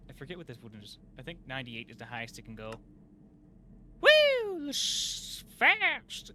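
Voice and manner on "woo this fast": very high, loud thrilled shout; excited whoop. Real, not acted. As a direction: whoop as it speeds up. Machine spins fast.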